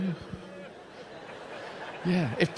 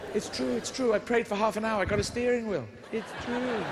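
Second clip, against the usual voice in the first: excited insisting; 7 semitones higher than usual. Acted. Excitedly insisting prayer worked.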